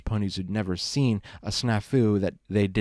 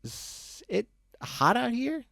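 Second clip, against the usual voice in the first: put-on high, sloppy drunk pony voice, much higher; drunk Loose Leaf; 14 semitones higher, slower than usual. Drunk buddy lecturing Brick on the road, high slurry drunk voice. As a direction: ramble like a happy drunk friend.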